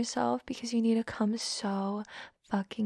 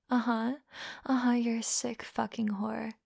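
ordinary delivery

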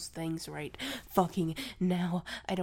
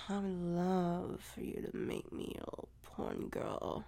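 ordinary talk

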